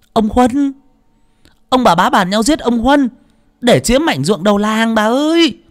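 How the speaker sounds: put-on high, loud, urgent child; panicked young boy. A narrator's reading. Servant boy telling the old lady who will be killed, panicked. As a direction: cry out the news in alarm. Boy reveals murder plot.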